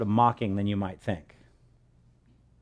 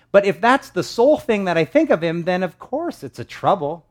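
preaching; 7 semitones higher, 8 dB louder than usual. Real, not acted. Preacher at full force.

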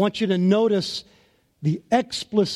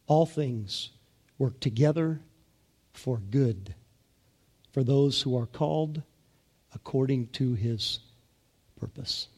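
phrase by phrase with pauses, softer; slower second reading; 5 semitones lower, 4 dB quieter than usual. Shown as a demonstration. Pastor re-reading the verse to a standing congregation, slow and soft. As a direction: let each phrase land on its own, quietly. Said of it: "One more time".

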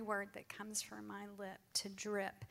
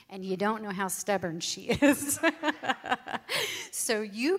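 laughing; 2 semitones higher, 12 dB louder than usual. Real, not acted. Wife retelling husband's plea, laughing.

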